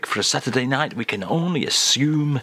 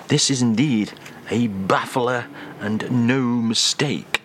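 normal talking voice

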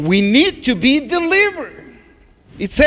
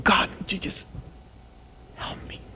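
a hushed, breathy whisper of a groaning prayer; 11 dB quieter, faster than usual. Retold or imitated. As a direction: whisper it as a plea to God. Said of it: "It is going".

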